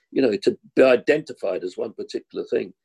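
normal talking voice

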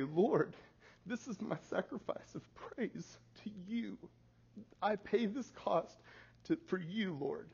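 high, halting address to God; modelled bedtime prayer, pleading upward; earnest prayer. Shown as a demonstration.

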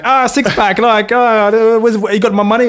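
high, loud mock landlord voice; Brian. Irish landlord asking tenant for rent, high mock shout. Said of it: "um, he's like".

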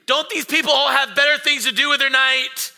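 high, loud exasperated outburst; hammed-up anger at other drivers; mock angry rant. Dad ranting at parked crowds, mock angry outburst. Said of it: "I'm getting angry".

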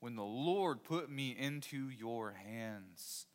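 very slow, each word weighed; slow it right down so they listen; slow emphatic reading. Shown as a demonstration. Preacher reading Saul's words to the congregation, slow and deliberate.

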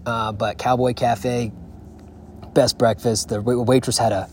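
ordinary talk

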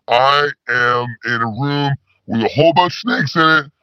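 ordinary talk

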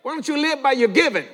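ordinary talk

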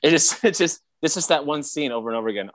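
ordinary talk, nothing marked

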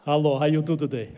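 put-on low-pitched comic Russian accent; mock Russian accent. Preacher in a Russian hat greets church, mock Russian accent. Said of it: "genuine military Russian hat".

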